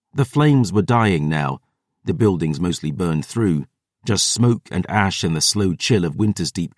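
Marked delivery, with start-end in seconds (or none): none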